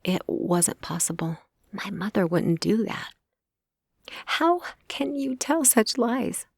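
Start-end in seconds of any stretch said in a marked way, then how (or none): 4.2-6.5 out of breath, disbelieving; breathless. A narrator's reading. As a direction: gasp the question out.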